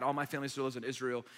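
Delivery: normal talking voice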